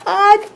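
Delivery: loud, high, explosive sneeze; big bear sneeze. Acted. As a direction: let the sneeze burst out of the bear. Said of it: "makes the bear go".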